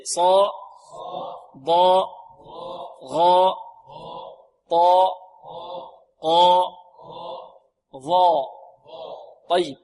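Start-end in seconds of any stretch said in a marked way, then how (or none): 0.0-8.5 long dark aa on صا، ضاء، غاء، طاء، ظاء; full-mouthed held syllables for repetition; heavy letters, unrounded lips. Shown as a demonstration. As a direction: drill each emphatic syllable alone, lips unrounded. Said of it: "بدون ضم الشفتين".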